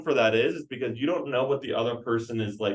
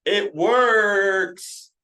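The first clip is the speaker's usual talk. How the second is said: loud, high, punched out; emphatic; 9 semitones higher, 7 dB louder than usual. Real, not acted. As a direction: hammer home that it works. Trainer stresses the key point.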